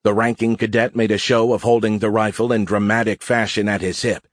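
ordinary delivery, nothing marked

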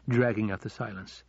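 usual speaking voice

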